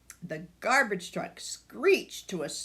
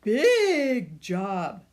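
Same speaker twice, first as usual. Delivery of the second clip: slow and weighty, a big job; louder, drawn-out grandma line; slow emphatic grandma; 6 dB louder, much slower than usual. Acted. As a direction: lean into it like a proud grandma.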